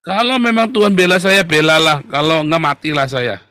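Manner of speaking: loud, high outburst, easing off at the end; defiant declaration. Real, not acted. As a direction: throw it out loudly, all or nothing. Defiant declaration of trust in God. (pasrah menantang dengan suara lantang)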